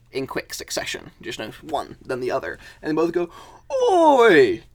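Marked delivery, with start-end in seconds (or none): 3.7-4.6 high, very loud mole bellowing; hammed-up fighting thug; outraged yell. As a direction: shout it as a deeply offended brawler. Both moles stabbed, yelling.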